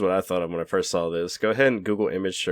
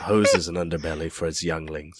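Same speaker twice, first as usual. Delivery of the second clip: nature documentary; 5 dB louder than usual. Acted. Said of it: "Here we see ... in the wild".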